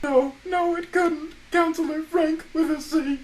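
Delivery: high voice on the phone; camp counselor voice. Acted. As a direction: play a chirpy camp counselor. Counselor voice continues.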